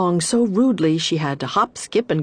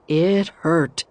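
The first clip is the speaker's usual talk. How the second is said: usual speaking voice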